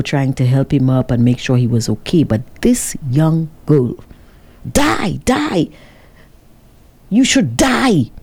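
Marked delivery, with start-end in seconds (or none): 4.7-8.1 raised, falling cries in a re-enacted tirade; retold yelling. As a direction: imitate her cursing him, pitch jumping up. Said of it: "started yelling at the man".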